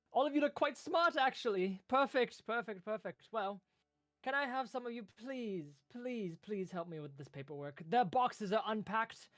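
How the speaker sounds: very high, loud delighted little clerk; squeaky high pleading clerk voice. Acted. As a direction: gush with relief and beg. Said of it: "and he kind of says".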